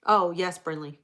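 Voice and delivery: ordinary talk, nothing marked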